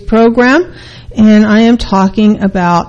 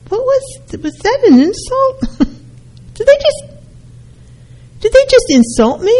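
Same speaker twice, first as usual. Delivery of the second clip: much higher, puzzled disbelief; confused disbelief. Retold or imitated. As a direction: sound baffled, was that an insult. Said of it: "you walk away going".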